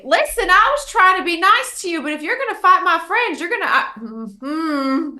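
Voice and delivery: rising angry warning to a foe; angry threat. Acted. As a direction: threaten him, getting heated. Character threatens enemy.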